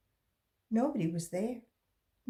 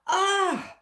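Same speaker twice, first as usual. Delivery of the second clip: high, loud frightened "Ah"; startled cry; 14 semitones higher, 9 dB louder than usual. A narrator's reading. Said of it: "she gasped".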